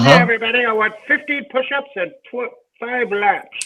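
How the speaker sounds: high squeaky little coach voice; tiny penis voice. Pretend. The penis head giving the team orders in a squeaky high voice. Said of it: "come from the head of his penis".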